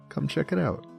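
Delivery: normal talking voice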